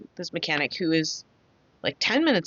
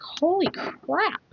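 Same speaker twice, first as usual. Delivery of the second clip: high, slow amazed holy crap; stunned disbelief; 12 semitones higher, much slower than usual. Retold or imitated. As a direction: drag it out in disbelief. Stunned at same-day repair.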